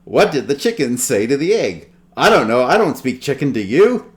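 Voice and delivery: hammy Fozzie joke; Fozzie Bear. Acted. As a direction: tell the joke like a nervous stand-up bear. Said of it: "you hear Fozzie go".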